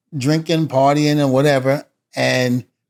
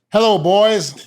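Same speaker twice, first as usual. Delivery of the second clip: lightly voiced higher old man's greeting; a hint of the boss, higher; imitating JR; 6 semitones higher, slower than usual. JR greeting them in the lobby.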